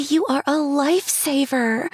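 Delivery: high, bright gushing voice; gushing bride-to-be. Acted. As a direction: gush with delight. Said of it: "Cherry gushed".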